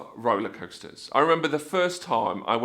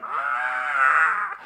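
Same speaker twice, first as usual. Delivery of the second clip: rising "aah" of terror, played for laughs; acted scream. Rider looking down the drop, acted scream.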